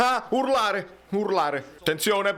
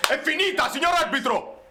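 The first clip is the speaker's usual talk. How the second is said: ordinary talk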